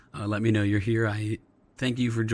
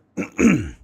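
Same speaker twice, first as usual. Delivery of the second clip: clearing a scratchy throat; short rough throat clear; 5 semitones higher, 8 dB louder than usual. Real, not acted. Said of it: "excuse me".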